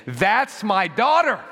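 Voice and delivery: loud, high, drawn-out cheer; proud father exulting. Acted. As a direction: boast about your child to everyone. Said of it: "he's looking at you and he's saying".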